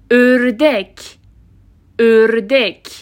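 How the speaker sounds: "اردک" slowly as ördek, starting with ö; rounded Ö example. Shown as a demonstration. Teacher giving an example, "اردک" enunciated with fronted ö. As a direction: round the lips on the first vowel of "اردک". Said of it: "ولی از ته حنجره صدای".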